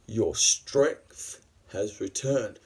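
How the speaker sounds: slow, drawn-out villain line swelling loud then dropping low; theatrical slow-paced menace; acted villain taunt. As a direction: start restrained, burst out, then sink slowly. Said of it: "he says".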